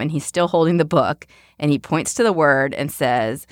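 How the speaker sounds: normal talking voice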